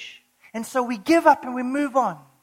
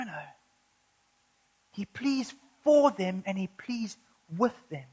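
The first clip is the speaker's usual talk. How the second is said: usual speaking voice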